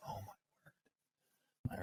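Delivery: breathy, drawn-out whisper of disbelief; hushed groan of frustration under the breath; exasperated whisper. Retold or imitated. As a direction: whisper it slowly, like you can't believe he won't shoot. Exasperated while waiting on shooter.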